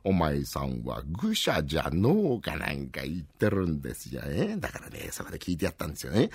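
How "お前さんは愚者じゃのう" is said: put-on slow, low chuckling old sage; creaky wise old man. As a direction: stroke your beard and pronounce it slowly. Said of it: "ひげを撫でながらね".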